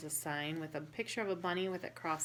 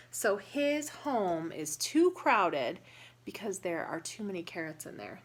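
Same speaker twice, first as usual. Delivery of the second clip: ordinary delivery, nothing marked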